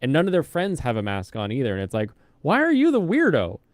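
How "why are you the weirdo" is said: high, loud mock disbelief; incredulous. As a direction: ask it like they're the weird one. Mocking mask wearer in retold thought.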